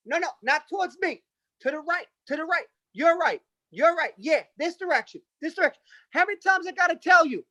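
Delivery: high-pitched frantic controller waving planes in; Italian air traffic controller. Retold or imitated. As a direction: shout directions like a flustered Italian controller. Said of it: "this is the reenactment what happened".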